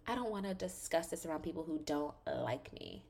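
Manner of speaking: soft, coy imitation; acting coy. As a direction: play coy, keeping it very quiet. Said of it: "Robin's all coy, acting like".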